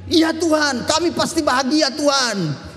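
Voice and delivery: jauh lebih tinggi, riang; jawaban gembira. Acted. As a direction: mengiyakan Tuhan dengan semangat. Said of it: "Harusnya kita bilang". (jemaat menjawab Tuhan dengan riang dan bernada tinggi)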